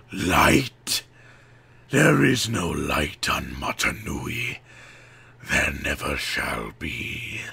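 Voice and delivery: low, slow, menacing villain; Makuta's voice. A narrator's reading. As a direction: sneer it from the dark. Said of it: "hissed Makuta".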